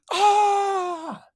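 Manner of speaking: sharp gasp into high cry; startled high shriek; gasp and high scream. Acted. Kitty surprised by natives, gasp and high scream.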